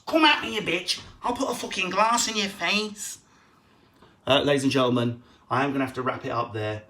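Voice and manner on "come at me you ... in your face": put-on much higher, loud drunken Manchester threat; wife after three drinks, screeching in a Manchester accent; drunk Manc aggression. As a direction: shriek it like a tipsy Manc brawler. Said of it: "Then after three drinks".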